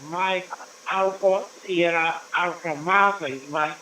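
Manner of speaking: normal talking voice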